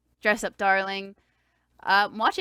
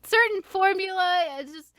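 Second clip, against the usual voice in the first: mock teary voice; 10 semitones higher than usual. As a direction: replay the tearful excuse, pitched way up. Quoting herself crying at anime.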